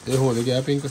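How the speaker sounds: ordinary talk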